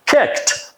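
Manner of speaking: "kicked" unvoiced, ending on a T sound; whispered kicked. Shown as a demonstration. Teacher showing "kicked" ending in T. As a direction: breathe out "kicked" with a crisp T. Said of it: "unvoiced consonant sound".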